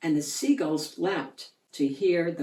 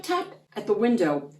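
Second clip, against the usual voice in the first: ordinary delivery, nothing marked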